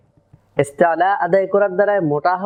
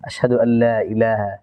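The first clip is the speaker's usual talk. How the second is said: closing prayer; 7 semitones lower than usual. Acted. Closing supplication.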